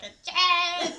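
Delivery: loud, high impatient call; yelling a name. Retold or imitated. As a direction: holler as if through the house. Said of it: "a yelling".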